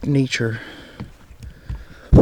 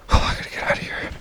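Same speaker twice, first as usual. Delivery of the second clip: fast, breathless whisper, scared; panicked whisper; 5 dB quieter, faster than usual. Real, not acted. Alone in the dark woods, whispering to himself in panic. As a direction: rush it out under your breath in real fear. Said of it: "Oh, I'm panicking".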